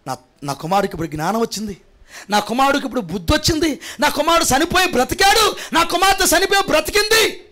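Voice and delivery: father rising from hushed to high joyful cry; rejoicing father building up. Acted. Father celebrating his returned son, joy rising to a high cry. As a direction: let the father's joy build until it rings out. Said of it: "ఎందుకో తెలిసిన?".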